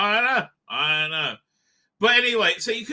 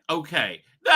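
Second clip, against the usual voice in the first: normal talking voice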